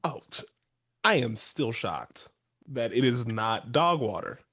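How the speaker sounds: ordinary talk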